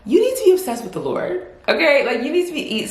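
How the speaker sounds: emphatic preaching. Real, not acted. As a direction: drive it home like a preacher. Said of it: "preaching emphasis".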